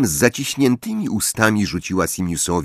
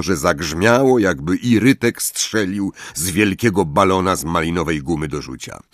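ordinary delivery

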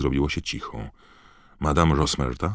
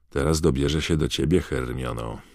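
normal talking voice

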